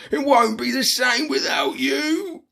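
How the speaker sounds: high shouted plea; pleading shout. Acted. Troll shouting a plea to his leaving friend. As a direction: call out, pleading.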